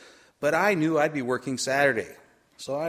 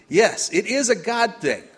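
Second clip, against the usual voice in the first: "Yes, it is a God thing" raised and emphatic; louder repeat; 5 semitones higher, 5 dB louder than usual. Shown as a demonstration. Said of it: "Let's practice".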